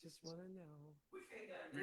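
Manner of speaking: slow, low breathy come-on; sexy voice. Pretend. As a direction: purr it like a mock seduction. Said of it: "It's my sexy voice".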